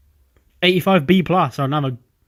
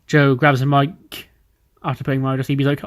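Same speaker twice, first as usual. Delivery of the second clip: normal talking voice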